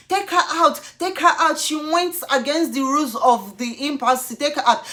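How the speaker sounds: high, loud command; ordering shout. Retold or imitated. Officer telling security to remove her, shouting. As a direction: bark it at security. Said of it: "overshadowing me".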